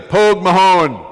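Pogue Mahone shouted slowly, falling at the end; loud teaching call. Shown as a demonstration. Speaker putting the Irish phrase together for audience, loud call. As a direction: belt out both words, drawn out for repeating. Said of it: "Then you put it together".

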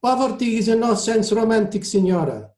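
put-on high-pitched Italian-accented retort. A narrator's reading. Maestro corrects the admiring passenger, Italian-accented retort. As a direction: contradict her in an Italian accent. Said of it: "Toscanini retorted".

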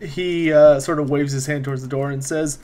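usual speaking voice

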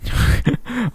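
short high awkward laugh; a real, uneasy little laugh. Real, not acted. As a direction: laugh awkwardly, starting high then falling. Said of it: "Awkward laugh".

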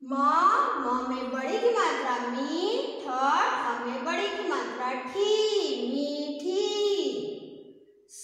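slow spelling drill, long 'ee' in मी, ठी, सी, टी; stretched long vowels. Shown as a demonstration. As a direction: draw out both vowels in मीठी and सीटी. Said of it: "थोड़ा खींच करके पढ़ा जाता है". (मीठी, सीटी में ी खींचकर)

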